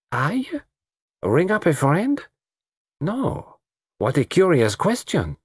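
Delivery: put-on high, accented astonishment; surprised coquette. Lola surprised by the detective's telephone question, coquettish. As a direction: echo it in high little pieces.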